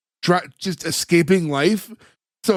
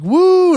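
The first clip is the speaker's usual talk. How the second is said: high, loud mock whoop; whooping cheer; 13 semitones higher, 8 dB louder than usual. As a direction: imitate carefree joyriders whooping. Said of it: "they're all like".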